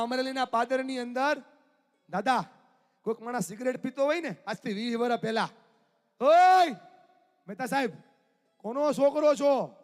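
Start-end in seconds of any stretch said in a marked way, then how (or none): 6.2-9.8 calling out. Retold or imitated. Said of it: "Dineshbhai એમ પૂછતા".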